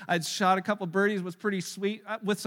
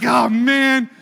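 loud groan of surrender; 12 dB louder than usual. Retold or imitated. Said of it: "I'm like".

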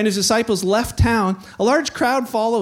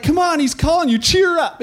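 high, eager encouragement; hammed-up change of heart; cheering on; 7 semitones higher, 4 dB louder than usual. Crowd tells Bart he is called, encouraging.

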